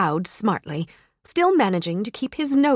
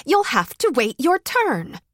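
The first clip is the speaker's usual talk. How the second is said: retort; 6 semitones higher than usual. Acted. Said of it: "she retorted".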